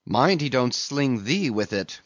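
ordinary delivery, nothing marked

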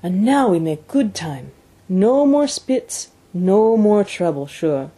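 pleased French-Canadian dog driver, slowing for emphasis; Francois. A narrator's reading. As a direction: stretch each phrase in a French-Canadian accent. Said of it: "was Francois's answer".

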